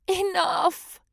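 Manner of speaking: high, pleading cry of the crowd; pleading geckos. Acted. Fed-up geckos plead with Goldie, high pleading cry. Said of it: "they implored".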